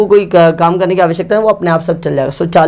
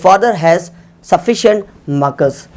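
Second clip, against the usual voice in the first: slow dictation; much slower than usual. Shown as a demonstration. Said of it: "पापा के पास पर्याप्त markers है तो".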